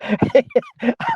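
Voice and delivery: real burst of laughter; laughing. Real, not acted. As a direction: crack up at a comment. Laughs at the chat.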